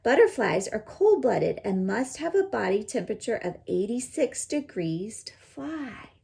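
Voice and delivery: ordinary delivery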